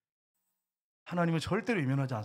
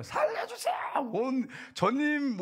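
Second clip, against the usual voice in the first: desperate shout; 10 semitones higher, slower than usual. Shown as a demonstration. Preacher showing a drowning man's cry, shouted. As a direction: scream it like you are drowning. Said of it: "온 먹던 힘을 다해가고 살려달라고 그러지".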